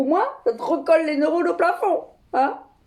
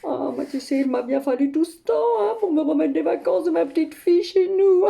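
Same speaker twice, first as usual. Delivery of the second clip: usual speaking voice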